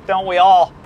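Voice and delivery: ordinary delivery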